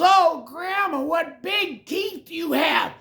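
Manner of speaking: high, loud, slow frightened cry; the girl panics at the teeth; alarmed girl. Acted.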